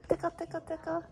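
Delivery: high playful singsong; giggly tickle attack. Acted. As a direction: coo it up high. Tickling the newborn.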